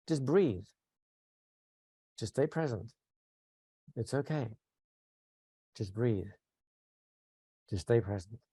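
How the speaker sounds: soft, low, soothing coaching; hushed reassurance with long pauses; energy coming down. Shown as a demonstration. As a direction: let the voice sink calmer with each phrase. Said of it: "my own emotional energy is coming down".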